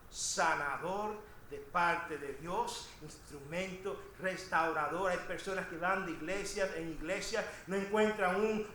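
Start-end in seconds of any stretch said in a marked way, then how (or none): none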